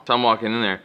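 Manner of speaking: usual speaking voice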